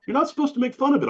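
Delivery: high, quick hammed-up tut-tutting voice; mock scolding. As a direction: parody the people who tell him off. Imitating people scolding his cancer jokes.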